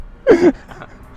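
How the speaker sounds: high, loud laugh breaking out; loud burst of laughter. Real, not acted. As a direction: laugh out loud as the prank is revealed. Prank revealed, big laugh. (ضحكة عالية مفاجئة)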